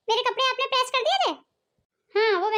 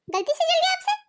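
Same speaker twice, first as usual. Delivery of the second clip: higher, incredulous echo; shocked disbelief; 6 semitones higher than usual. Acted. Daughter-in-law echoes sister-in-law's excuse for burnt top, incredulous. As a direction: throw her excuse back in disbelief.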